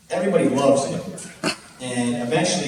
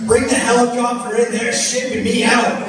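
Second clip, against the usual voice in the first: put-on high, loud drunk announcement to the crowd; drunk showman; 11 semitones higher, 6 dB louder than usual. Drunk guy rallying the crowd, high loud proclamation. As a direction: proclaim it like a tipsy ringleader.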